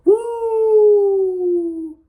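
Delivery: high, loud "woo" whoop; thrilled shout about the next episode; excited whoop. Real, not acted. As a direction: let out a joyful cheer.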